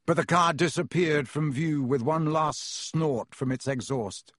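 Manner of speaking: ordinary delivery, nothing marked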